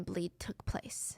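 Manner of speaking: hushed, voiceless aside; whisper. Real, not acted. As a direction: breathe the grim detail out as a secret. Grim detail dropped to whisper.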